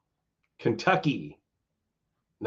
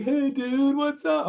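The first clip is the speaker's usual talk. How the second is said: much higher, friendly laid-back dude voice; a Pauly Shore style hey-dude, pitched way up; Ogre's sweet greeting; slower than usual. Retold or imitated. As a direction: imitate a scary singer sounding surprisingly sweet. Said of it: "And he's like".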